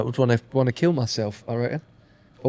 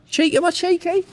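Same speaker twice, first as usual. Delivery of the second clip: high, indignant question; mock indignant; 13 semitones higher than usual. Real, not acted. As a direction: act playfully offended.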